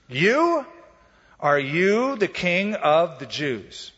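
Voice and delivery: disbelieving, slowed down, pressing "you"; scornful stress on you. Acted. Pilate scorns the battered peasant, stressing "you". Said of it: "That's how it's written in the original".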